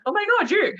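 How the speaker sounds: high squeal imitating a thrilled friend; excited greeting. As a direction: echo the delighted shriek of recognition. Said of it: "And she's like".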